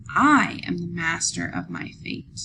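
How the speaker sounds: I and master pressed firmly, I am the master of my fate; I and master given weight in the line; stress on I am master. Shown as a demonstration. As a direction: lean into I and master to show the rhythm. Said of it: "I am master seem to be emphasized".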